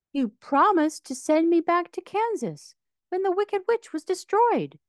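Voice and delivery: put-on high, bright girl's plea; a young girl holding the wizard to his word; Dorothy's voice. A narrator's reading. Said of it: "said the girl".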